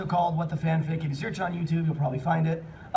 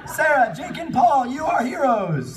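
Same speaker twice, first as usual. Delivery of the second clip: higher, louder hammed-up hero proclamation; mock grand praise; 8 semitones higher, 5 dB louder, slower than usual. Reading the fic's crowd praising the heroes, hammed-up proclamation.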